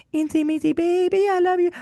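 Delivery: put-on very high cooing baby talk. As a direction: coo it to a baby. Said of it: "I would sing sweet so".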